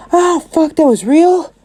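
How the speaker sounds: very high-pitched yelp of disbelief; pained shock. Acted. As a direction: cry out high, stunned at being shot. Monoma shot in the kneecap.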